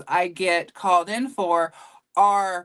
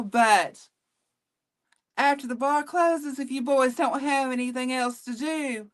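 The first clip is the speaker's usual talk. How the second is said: Southern cougar; 4 semitones higher than usual. Shown as a demonstration. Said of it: "just a little Southern cougar for you".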